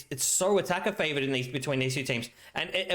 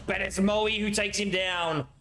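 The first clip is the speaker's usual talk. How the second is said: ordinary delivery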